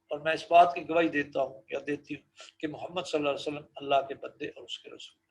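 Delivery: normal talking voice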